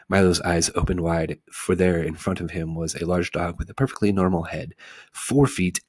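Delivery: ordinary delivery, nothing marked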